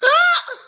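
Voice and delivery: short, loud high laugh; burst of laughter. Real, not acted. Reactor reacting to Bahati's comeback, loud laugh. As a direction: let out one high hoot of laughter. Cracking up at the show.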